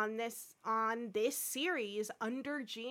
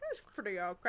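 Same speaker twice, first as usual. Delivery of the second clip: mock Scotty; 3 semitones lower, much faster than usual. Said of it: "I love that".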